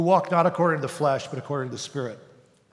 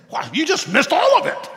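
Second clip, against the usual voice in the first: much higher, louder sneering accuser; condemning voice; 11 semitones higher, 5 dB louder than usual. Acted. The voice of condemnation nagging the believer, high sneer. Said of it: "when it says".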